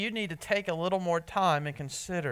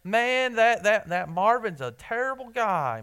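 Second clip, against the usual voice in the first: louder, higher sudden flare of anger; mock angry outburst; 5 semitones higher, 6 dB louder than usual. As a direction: blurt it like you've snapped. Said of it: "you just shot up and said".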